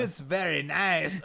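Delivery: mocking a friend with a Borat accent; Borat impression. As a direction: drag it out in a hammy Borat accent. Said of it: "always either Borat or Fat Bastard".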